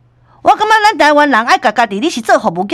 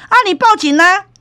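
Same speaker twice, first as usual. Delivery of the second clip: defiant dare; 9 semitones higher, 3 dB louder than usual. Retold or imitated. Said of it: "这查甫这行就讲".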